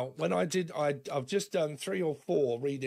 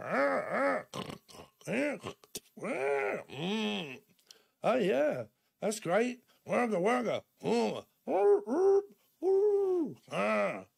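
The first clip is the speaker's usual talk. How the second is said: higher playful alien humming and babble; alien noises; 7 semitones higher, slower than usual. Retold or imitated. Mock alien chatter between family, higher and playful. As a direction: coo like a silly little alien. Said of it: "is it like alien how you speak".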